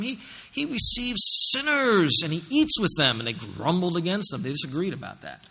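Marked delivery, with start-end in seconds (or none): 0.0-3.2 mild mock outrage at sinners; grumbling disapproval. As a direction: grumble it like a scandalized critic. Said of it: "they started grumbling against them".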